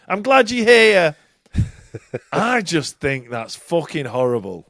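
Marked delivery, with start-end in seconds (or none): 0.1-1.2 mock [name]. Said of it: "[name] said".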